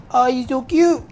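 high-pitched cutesy gushing; mock cooing. Gamer admiring a little guardian, mock cutesy cooing. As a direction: coo as if at a tiny pet.

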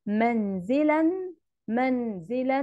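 "منزلًا" sounded as manzilan, final n held; tanween as spoken n. Shown as a demonstration. Teacher reads the word for pupils, ending "منزلًا" on a clear n. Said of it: "أنا نطقتها بالشكل ده".

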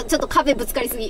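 usual speaking voice

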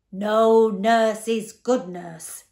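very loud, slow booming interruption; roaring joke. A narrator's reading. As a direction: bellow the punchline, dragging out each word. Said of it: "doubling up with laughter".

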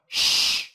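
unvoiced "sh" with rounded lips; SH sound. Shown as a demonstration. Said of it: "đọc tròn môi, sau đó thổi hơi ra". (âm "sh" tròn môi, thổi hơi ra)

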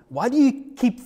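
high, pushed exasperated question; retold frustration at Johnny; exasperated demand.

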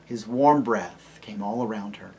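usual speaking voice

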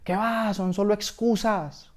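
high, drawn-out scolding; scornful dismissal. Acted. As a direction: brush off the excuse with indignation. Angry sock rejects his excuses.

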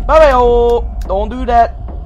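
high, loud startled protest; jolted by a fright; scared. Real, not acted. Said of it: "That scared me".

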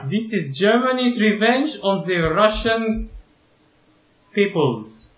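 high, badly faked German accent. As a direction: declaim in a dubious German accent. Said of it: "you're doing a German accent".

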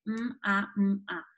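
"m, a, m, a" said phoneme by phoneme; a child splitting "m, a, m, a" into sounds; segmented phonemes. Shown as a demonstration. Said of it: "todos los fonemas de la palabra". ("m, a, m, a" fonema a fonema)

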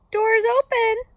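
very high, loud granny voice; fake Granny calling. A narrator's reading. Wolf posing as Granny lets Little Red in, high loud call. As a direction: call it out in a put-on falsetto.

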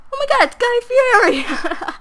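thrilled realization, voice shooting up; excited shriek. Real, not acted. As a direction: blurt out the discovery with glee. Realizes the name joke.

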